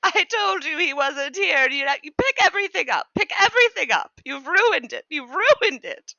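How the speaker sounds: lightly squeaky, petulant servant; indignant creature. Acted. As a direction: sulk and scold the one who ruined it. Anton scolds the player.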